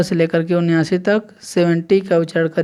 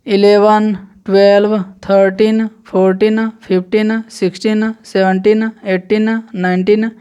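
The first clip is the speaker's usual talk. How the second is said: numbers called out louder, one by one; counting drill; 3 semitones higher, 4 dB louder than usual. Shown as a demonstration. Teacher counting eleven to nineteen for students, emphatic drill. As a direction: recite each clearly for the class. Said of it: "अब यहाँ से लेकर के nineteen तक".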